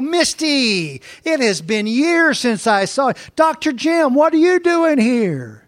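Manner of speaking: high, bright mock-surprise at seeing an old friend; mock surprised greeting. Pastor greeting the food inspector, high mock surprise. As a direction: act delighted and startled to see her. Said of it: "I go".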